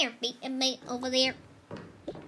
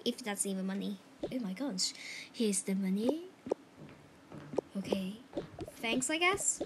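ordinary delivery

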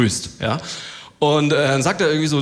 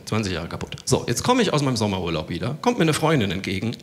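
ordinary talk, nothing marked

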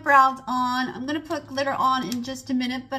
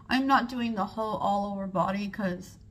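ordinary talk

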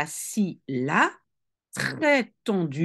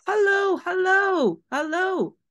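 calling bird voice; 11 semitones higher than usual. Acted. Teacher voicing the bird calling down to her. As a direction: call down like a parrot.

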